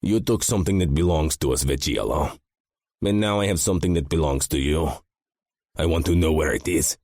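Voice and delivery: lightly voiced Russian mobster making demands; light Russian accent. A narrator's reading. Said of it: "in that fucking annoying accent".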